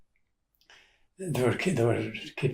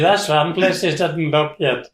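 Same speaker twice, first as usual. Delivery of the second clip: mildly raised, higher voice; bold retold challenge; 5 semitones higher, 5 dB louder than usual. Aunt daring the searchers on, boldly raised voice. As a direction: play up the cheeky dare a little.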